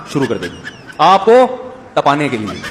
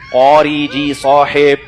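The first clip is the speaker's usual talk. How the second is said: very slow, loud, over-careful address; slow formal diction; 4 dB louder, much slower than usual. Retold or imitated. Pupil calling his teacher with slow tajweed diction. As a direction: stretch out each syllable formally. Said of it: "पूरी तजवीद के साथ ना".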